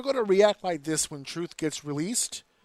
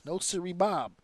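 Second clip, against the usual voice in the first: normal talking voice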